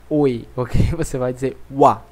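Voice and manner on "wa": "wa", not "oi"; French oi as wa. Shown as a demonstration. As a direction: say "wa" for the French oi sound. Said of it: "a gente diz wa".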